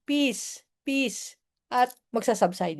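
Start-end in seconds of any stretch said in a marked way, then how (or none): 0.1-1.3 calm peace mantra. Shown as a demonstration. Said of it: "just say". (mahinahong pagsabi ng peace)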